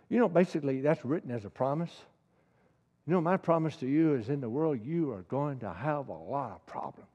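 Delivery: ordinary talk, nothing marked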